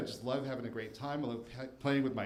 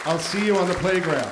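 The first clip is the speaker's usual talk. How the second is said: big loud sign-off; 5 semitones higher, 13 dB louder than usual. Real, not acted. Closing line to audience.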